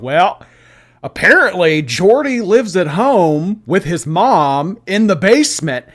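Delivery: loud, high, drawn-out mocking quote; exaggerated singsong jab, imitating her; mocking imitation. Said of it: "she has said things like".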